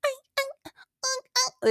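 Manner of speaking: very high little mock moans; imitated woman's moans. Podcaster shows listeners how the audio sounded, high mock moaning. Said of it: "it was giving that".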